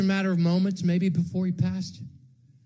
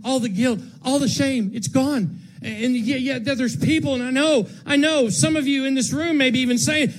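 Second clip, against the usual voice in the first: normal talking voice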